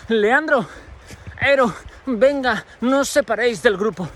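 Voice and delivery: teacher calling out. Retold or imitated. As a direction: round up the two lost students.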